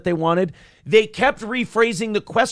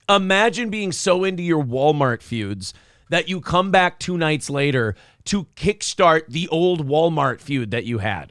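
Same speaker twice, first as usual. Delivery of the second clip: normal talking voice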